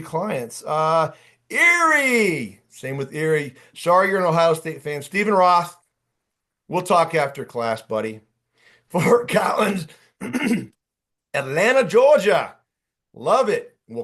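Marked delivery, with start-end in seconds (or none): 1.5-2.6 high, loud drawn-out call; excited shout-out. Real, not acted. Coach greeting an attendee's home town, excited shout-out. 8.9-12.6 higher, louder roll-call cheer; excited shout-out. Real, not acted. Coach reading attendees' towns from chat, excited shout-out.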